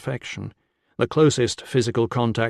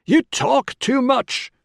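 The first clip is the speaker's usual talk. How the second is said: slow, loud, high shout; angry roar; 14 semitones higher, 5 dB louder, slower than usual. A narrator's reading. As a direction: roar each word to silence her. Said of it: "Con roared".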